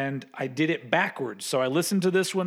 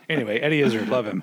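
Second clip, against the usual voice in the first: ordinary delivery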